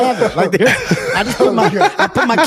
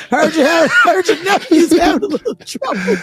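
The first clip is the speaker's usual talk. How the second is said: high, laughing heckle; mock heckler call; 7 semitones higher than usual. Repeating a fan's family jab, high laughing mock taunt. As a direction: playfully needle them like a fan.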